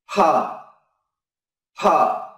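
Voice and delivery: "thở" said with a puff of breath; aspirated p. Shown as a demonstration. Teacher sounding out the aspirated sound for learners. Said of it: "phát âm là".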